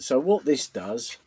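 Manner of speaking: normal talking voice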